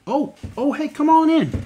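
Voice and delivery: high, loud excited welcome; excited greeting. Acted. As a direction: throw the door open with delight. Greeting guest at door.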